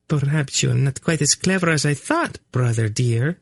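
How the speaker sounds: high, lightly purred teasing; purring. A narrator's reading. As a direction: purr it sweetly at your brother. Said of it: "she purred at him".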